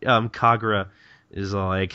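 normal talking voice

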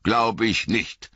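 mildly grim, drawn-out disbelief; understated stern wizard, slowly unconvinced; a touch of dark scepticism. A narrator's reading. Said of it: "sagte Gandalf finster".

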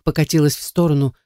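ordinary delivery, nothing marked

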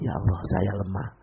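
very soft, low surrender; a servant quietly admitting weakness to God; humble murmur. Retold or imitated. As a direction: barely voice it, humbled. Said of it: "Langsung diam dan dia merasakan".

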